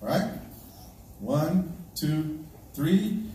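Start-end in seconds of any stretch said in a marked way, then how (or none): none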